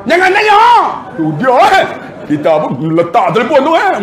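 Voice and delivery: high, loud indignant outburst; irate boss. Acted. Boss refusing a leave question, irate high-pitched outburst. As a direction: snap back in a high voice. Acts irate Puan Hajar on phone.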